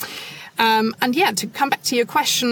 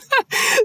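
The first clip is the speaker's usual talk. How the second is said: a quick, high burst of laughter; short laugh. Real, not acted. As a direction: laugh lightly at the memory. Laughing at her own anecdote.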